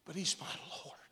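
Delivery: breathy, barely voiced whisper; hushed whisper. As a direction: lean in and confide it in a whisper. Intimate confession to congregation.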